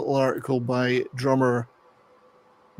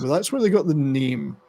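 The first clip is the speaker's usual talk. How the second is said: normal talking voice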